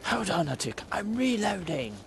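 cheeky mock English callout; English accent. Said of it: "I'm very English, and that was probably terrible".

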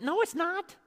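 high, mock-indignant no; indignant protest. Preacher protesting the verse about mourning, high and indignant. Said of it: "I said".

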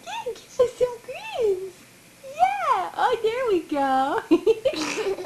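high, slow laughing coo. Real, not acted. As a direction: laugh with joy at the grin. Mother laughing at baby's grin.

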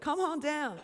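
high, brassy game show call; imitating a game show announcer; game show host. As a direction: belt it like a TV host calling up a contestant. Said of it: "kinda like a, like a show".

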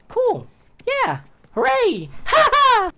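very high celebratory squeals; squeaky cheering. Pretend. Player celebrating a recovered arrow, high squeaky cheer.

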